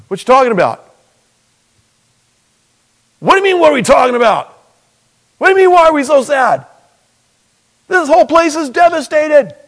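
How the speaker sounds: high, loud, fast disbelieving shout; loud incredulous outburst. Retold or imitated. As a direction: yell it like he can't believe the question. Disciples incredulous at stranger.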